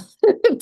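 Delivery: high, loud laugh; burst of laughter. Real, not acted. Guest laughing about touring while injured, loud laugh. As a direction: let out a sudden bright laugh.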